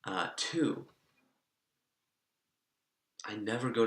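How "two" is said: ordinary talk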